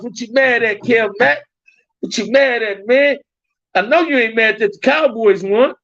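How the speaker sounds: high-pitched, insistent repeated question; playful challenge. Real, not acted. Streamer asking a viewer what he's mad at, playful challenge. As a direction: needle him in a high, incredulous voice. Teasing Kev Mack about Cowboys win.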